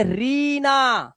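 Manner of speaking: रीना with a long drawn-out ee. Shown as a demonstration. Teacher showing the long Hindi vowel, drawn out. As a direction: stretch the first vowel of रीना. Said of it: "बड़ी की मात्रा लगी हो".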